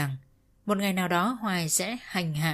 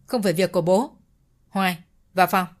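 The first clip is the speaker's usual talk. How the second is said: curt; 4 dB louder than usual. A narrator's reading. Út brushes off father.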